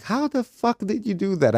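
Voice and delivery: high-pitched, drawn-out incredulity; slow, high mock amazement; amazed disbelief. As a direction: mimic a baffled, astonished crowd. Voices amazed audience after the act.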